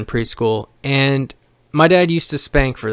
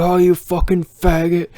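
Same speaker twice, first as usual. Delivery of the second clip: higher-pitched mock scream of abuse; imitated scream; 5 semitones higher than usual. Son imitating father screaming at him, mild mock scream. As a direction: imitate a yelled insult without full volume. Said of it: "just scream at you".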